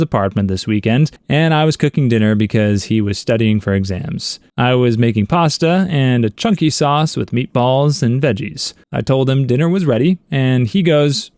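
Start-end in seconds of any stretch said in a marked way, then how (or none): none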